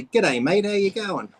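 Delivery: Australian greeting, lifting at the end; Australian accent. Shown as a demonstration. Showing co-host what to say, Australian accent. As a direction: demonstrate an Australian "G'day". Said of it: "Just say that. Say".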